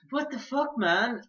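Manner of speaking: high-pitched retold disbelief. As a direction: react baffled, pitch jumping up. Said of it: "And I was like".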